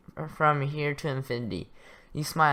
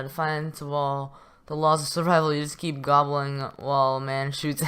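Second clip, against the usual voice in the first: ordinary talk, nothing marked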